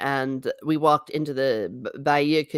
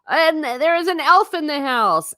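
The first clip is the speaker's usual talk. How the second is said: excited greeting; 11 semitones higher, 5 dB louder than usual. Real, not acted. Greeting a chatter arriving.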